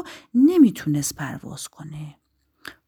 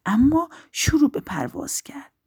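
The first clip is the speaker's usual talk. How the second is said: ordinary delivery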